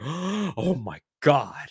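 slow, breathless gasp of awe; amazed gasp. Retold or imitated. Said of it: "I was like, gasps".

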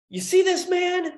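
high, loud retold proclamation; judge addressing the courtroom. Judge makes an example of him, imitated loud announcement. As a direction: call it out to the whole room as the judge.

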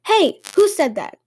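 put-on startled "hey"; feigned surprise. A narrator's reading. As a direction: act surprised, as if caught unawares. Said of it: "Hikohachi acted surprised".

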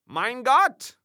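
loud, high German cry; startled exclamation. A narrator's reading. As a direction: exclaim in dismay. Angel exclaims at tears.

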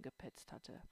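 plain reading voice

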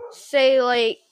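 ordinary talk

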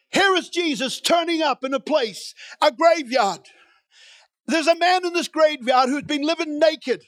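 ordinary talk

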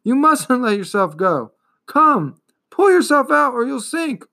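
high, urgent pleading, building in pitch; desperate cry. A narrator's reading. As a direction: cry out to save a sinking friend. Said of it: "cried Atreyu".

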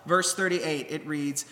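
ordinary delivery

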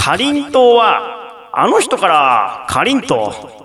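slow, stretched delivery with swooping pitch; pun recital. Pretend. Host presents a listener's pun, slow theatrical recital. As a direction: draw out each phrase toward the punchline.

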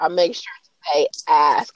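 whispered and careful. Real, not acted. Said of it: "I'm very cautious about ask".

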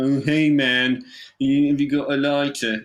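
slow, dragged-out slacker voice; scruffy stoner type. Acted. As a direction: play a spaced-out guy cadging a light. Plays his scruffy younger self.

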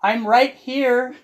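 slow, high, loud singled-out-victim call; mock calling out. Mocking someone walking through the house, calling out loudly. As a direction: announce yourself loudly to the lurking danger, drawn out.

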